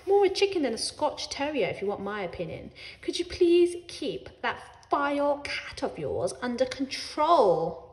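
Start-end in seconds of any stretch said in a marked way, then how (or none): 3.0-7.9 nasty, measured complaint with a louder end. A narrator's reading. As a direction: say it nastily, drawing the words out. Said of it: "Missus Fisher said nastily".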